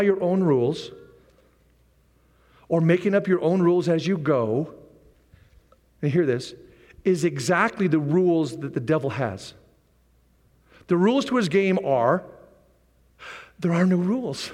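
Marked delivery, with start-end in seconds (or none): none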